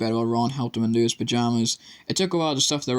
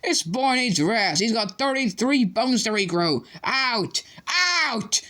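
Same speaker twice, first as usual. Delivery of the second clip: high, slow shouted orders; shouting nurse; 11 semitones higher, slower than usual. A narrator's reading. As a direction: throw them out.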